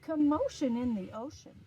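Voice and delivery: title sung out to the class. Shown as a demonstration. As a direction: announce it with a big voice. Repeats title loudly.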